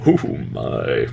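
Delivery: slow, soft "oh, oh my"; toned-down Takei. Shown as a demonstration. Showing the proper restrained Takei "oh my". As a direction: pull it back, slow and quiet. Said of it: "back off just a little bit".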